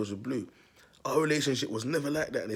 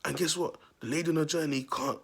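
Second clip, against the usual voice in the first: ordinary talk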